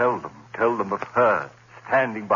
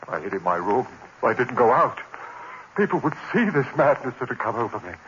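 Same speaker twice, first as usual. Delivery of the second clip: ordinary talk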